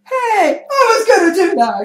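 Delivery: very high, loud laughing protest; indignant outburst. Retold or imitated. Assassin robbed of his kill, high loud laughing protest. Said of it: "the assassin's like".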